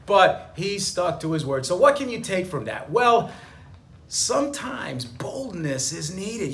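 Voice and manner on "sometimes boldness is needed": hushed, slow whisper of advice; slow confiding whisper. Real, not acted. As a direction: lean in and whisper it like a secret. Lesson drawn, sudden hush.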